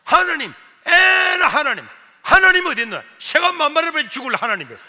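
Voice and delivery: high, very loud shouted abuse; acted shouting curse; cursing mother. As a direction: rail furiously at God as the angry mother. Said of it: "막 욕을 하는 거야".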